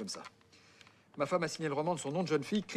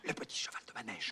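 a soft, hidden hint during the call; prompting a friend on the phone, whispered; 5 semitones lower, 8 dB quieter than usual. Real, not acted. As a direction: whisper the title to the caller. Prompting the caller under his breath.

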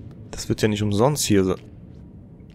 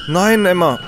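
dismayed cry; 10 semitones higher, 5 dB louder than usual. Real, not acted. Character failing again.

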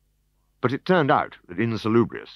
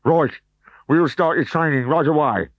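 brute trainer; 8 semitones higher, 5 dB louder than usual. Acted.

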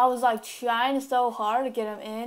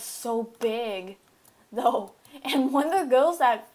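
ordinary talk, nothing marked

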